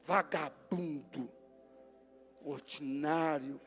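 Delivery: a hint of a bitter arguer; muttered mock insults. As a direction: grumble the insults under the breath.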